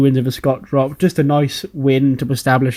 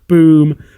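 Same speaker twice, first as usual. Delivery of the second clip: loud boom; 3 semitones higher, 6 dB louder than usual. Retold or imitated. Imitating a finishing move impact.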